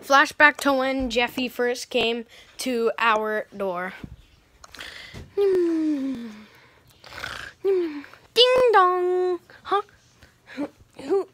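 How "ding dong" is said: sung doorbell chime; high sing-song ding dong. Acted. Doorbell sound imitated.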